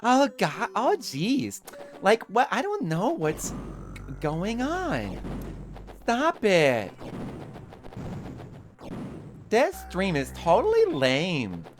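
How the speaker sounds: very high-pitched whiny character voice; squeaky, exasperated put-on voice; Mint voice. Shown as a demonstration. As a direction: put on a high, complaining falsetto. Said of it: "maybe I should just stream in the Mint voice".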